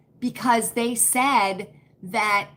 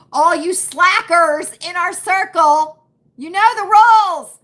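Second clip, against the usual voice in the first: louder, higher teasing scold; mock scolding; 5 semitones higher, 10 dB louder than usual. Host teasing her circle slackers with a mock loud scold. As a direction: playfully tell the slackers off.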